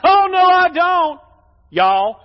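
loud, high, drawn-out Southern denial; exaggerated Southern accent. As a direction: protest loudly in a hammed-up Southern drawl. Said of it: "You're from the South".

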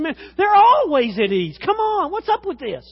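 high, loud, fed-up protest; indignant complaint. Retold or imitated. Psalmist complaining loudly that the wicked are always at ease. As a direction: complain about the unfairness of it. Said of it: "He says".

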